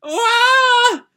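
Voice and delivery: very high, loud crying wail; piercing child's cry. Acted. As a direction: bawl like a little girl.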